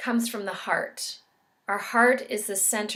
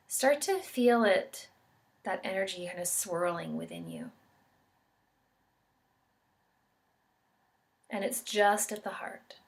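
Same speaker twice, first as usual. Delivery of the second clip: calm, measured meditation guide with long pauses; guided meditation. Real, not acted. Yoga instructor guiding listeners into an expansiveness meditation, calm and unhurried. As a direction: guide them gently through each breath, leaving space between phrases.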